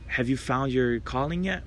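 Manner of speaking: ordinary delivery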